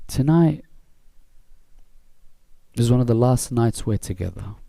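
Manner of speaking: slow, grave telling with long pauses; solemn lament narration. Acted. Preacher leading listeners into the moment in Karbala, solemn. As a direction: let each phrase hang before the next.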